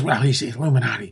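low, muted mimicked complainer; mock emailer. Host mimicking listeners who send emails, low mocking voice. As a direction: put on a low, soft mock voice. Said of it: "please don't give me the emails".

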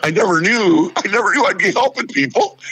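high-pitched, astonished protest; mock amazement. Host amazed he helped a listener, high-pitched disbelief. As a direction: marvel at it, voice climbing high.

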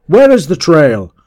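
loud, high, demanding question; enraged shout. A narrator's reading. Master searching for the lost trail, enraged shout. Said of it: "He shouted aloud with rage".

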